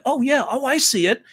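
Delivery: a sudden delighted discovery; realizing. Retold or imitated. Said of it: "it's like".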